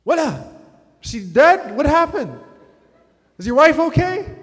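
very high, loud frantic questions; panicked father. Retold or imitated. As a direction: imitate a dad yelling in panic. Father panics, fearing a miscarriage.

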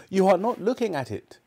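high, quick protest as Scrooge; agitated Scrooge. Acted. Scrooge contradicting the ghost, high-pitched protest.

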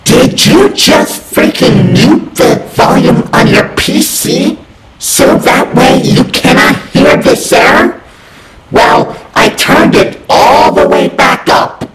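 furious high shouting, loud and harsh; angry yelling. Acted. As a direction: blast every word at them in rage. Furious error message yelling at user.

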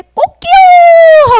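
high, loud, drawn-out cock-a-doodle; rooster crow. Acted. The rooster crows.

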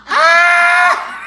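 very high, loud squeal of joy; delighted scream. Real, not acted. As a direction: scream with delight, breaking into laughter. Screams at sticker.

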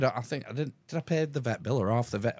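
ordinary talk, nothing marked

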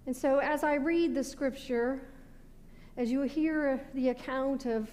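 ordinary talk, nothing marked

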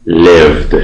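lived ending in a plain d sound, no extra syllable. Shown as a demonstration. Teacher modelling lived for students.

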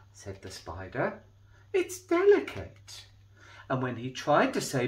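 put-on lively read-aloud for children; storybook reading. A narrator's reading. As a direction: tell it like a bedtime picture book. Reading a picture book aloud.

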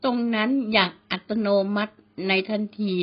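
usual speaking voice

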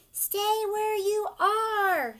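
high, loud and slow call across the water; shouting. Acted. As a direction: shout it out to stop them moving. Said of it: "shouted the citizens".

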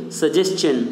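normal talking voice

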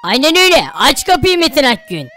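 stern police officer. Acted. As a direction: order him out like a tough cop. Police raid at the door. (alçak sesli sert polis)

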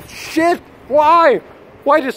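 high-pitched cry of regret, rising in volume; exasperated outburst. Real, not acted. Broke shopper berates himself over blown money, exasperated high cry. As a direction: burst out high, ending in a loud wail.

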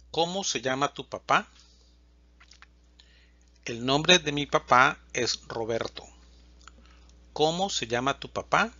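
usual speaking voice

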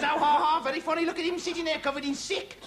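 high-pitched sarcastic taunt; mocking jeer. Conductor mocking a passenger to the bus, jeering. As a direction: jeer at him for the whole bus to hear.

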